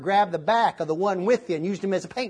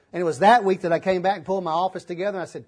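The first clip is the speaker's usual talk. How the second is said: ordinary talk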